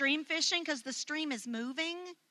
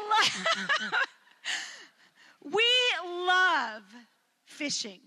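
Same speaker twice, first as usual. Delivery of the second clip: laughing; 2 semitones higher, much slower than usual. Real, not acted. Laughing fit mid-sentence.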